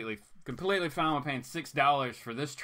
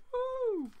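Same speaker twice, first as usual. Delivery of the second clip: excited woo. Real, not acted. Celebrating a cheap price.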